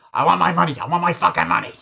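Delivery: loud insistent demand; mock wig guy demanding money; angry demand. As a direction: push hard for the cash.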